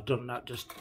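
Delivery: ordinary delivery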